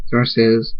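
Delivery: ordinary delivery, nothing marked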